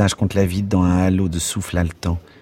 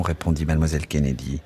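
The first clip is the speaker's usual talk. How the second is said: plain reading voice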